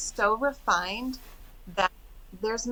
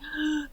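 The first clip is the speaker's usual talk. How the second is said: mock gasp of realisation; sharp, acted intake of breath; shocked gasp; 8 semitones higher, 4 dB quieter than usual. Reacting to a lady who remembers her, shocked gasp. Retold shock at being recognised.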